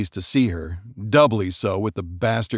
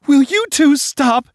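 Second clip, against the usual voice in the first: much higher, louder desperate cry; 8 dB louder than usual. Acted. Bride crying out at fighting parents, desperate. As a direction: beg them to stop at full voice. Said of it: "Leslie cried out".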